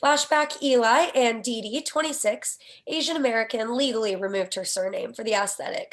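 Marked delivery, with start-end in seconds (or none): none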